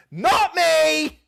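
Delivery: high, loud mock cry; over-the-top melodramatic outburst; hammed-up film quote. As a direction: belt it out dramatically for a laugh. Hammed-up film line quote, laughter.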